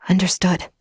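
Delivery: ordinary delivery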